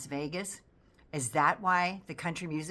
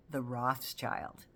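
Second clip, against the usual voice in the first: slow, lower and pointed "the Rothschild"; pointed emphasis; 5 semitones lower, 4 dB quieter, much slower than usual. Acted. Pointing out an antisemitic dog whistle, slow emphasis. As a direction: drag out "the Rothschild" slowly and knowingly.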